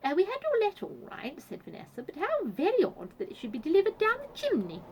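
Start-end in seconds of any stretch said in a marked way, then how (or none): 0.0-1.4 high, loud hostess voice; genteel Vanessa. A narrator's reading. 2.0-4.8 high-pitched, loud polite puzzlement; genteel Vanessa. A narrator's reading.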